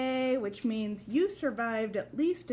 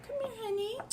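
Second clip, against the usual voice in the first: high, soft coaxing to the dog; sweet pet-talk call; coaxing puppy; 9 semitones higher, 6 dB quieter, much faster than usual. Real, not acted. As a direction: coax her gently, much higher and softer. Coaxing scared puppy over.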